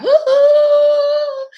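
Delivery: high, loud, long woohoo; joyful whoop. Real, not acted. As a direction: cheer them in with a big high whoop. Cheering a new arrival.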